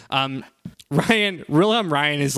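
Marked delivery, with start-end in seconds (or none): none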